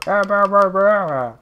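high, loud, squawking robot mimicry; mocking robot scream. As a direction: screech it like an annoying robot. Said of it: "All he does is scream at me".

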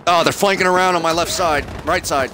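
urgent shout. Real, not acted. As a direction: yell the warning over gunfire. Firefight callout under attack.

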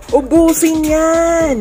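loud, high, drawn-out cheer; excited urging. Real, not acted. Vlogger urging everyone to finish the food, excited.

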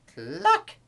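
sharp high cluck; hen's cluck. A narrator's reading. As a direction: cluck like a startled hen. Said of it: "said the hens".

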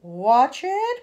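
loud sharp warning; alarmed spider's cry; cried warning. A narrator's reading. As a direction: shout it as the room lurches. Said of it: "cried Miss Spider".

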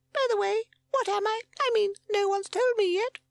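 very high, loud squeaky chick; little chick. A narrator's reading. Bundle of fluff asking Sid, high squeak. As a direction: pipe up with a squeaky question. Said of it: "said the bundle of fluff".

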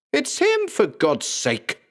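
sudden very high blurt; blurted outburst. Acted. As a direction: burst it out. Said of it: "Blackgarden blurted".

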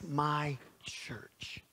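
trailing into a near whisper; mock wistful hush. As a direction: let it sink, low and whispered. Dreamy wish for easy church.

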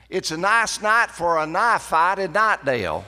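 high, loud North Carolina country accent with stretched vowels; country dialect demo. Shown as a demonstration. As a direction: show off the local Knightdale accent, loud and high. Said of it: "I was trying to demonstrate this".

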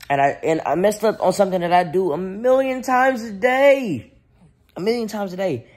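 can't believe he messed up; dad venting about a diaper mishap, exasperated; exasperated disbelief. Real, not acted. Exasperated at own mistake.

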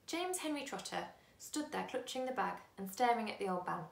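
plain reading voice

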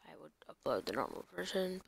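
unvoiced whisper, almost to himself; whispered aside. Real, not acted. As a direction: murmur it under your breath.

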